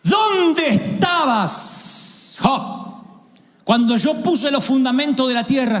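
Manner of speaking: slow, high, booming divine questioning; God confronting Job, slow and grand. Acted. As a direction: ask it like God challenging Job. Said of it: "Lo voy a leer tal cual".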